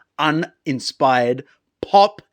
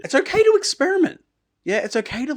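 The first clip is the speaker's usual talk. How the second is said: squeaky high reassurance; 14 semitones higher, 4 dB louder, faster than usual. Real, not acted. Advising Taylor.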